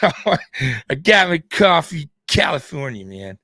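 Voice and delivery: slow, lazy surfer dude drawl, lightly done; a hammed-up California surfer dude accent. Host mocking California time, slow surfer dude accent. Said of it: "trying to sound like a surfer dude".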